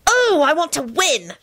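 high, slow, gleeful longing; high-pitched acted glee; shriek of delight. A narrator's reading. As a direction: squeal with eager hope. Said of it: "shrieked with delight".

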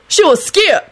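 high, indignant holler; high-voiced scolding. A narrator's reading. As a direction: protest it up high. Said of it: "Lucretia hollered".

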